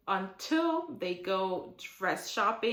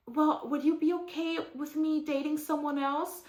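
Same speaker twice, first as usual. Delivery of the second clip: mock girlfriend voice; 7 semitones higher than usual. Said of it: "And she's like".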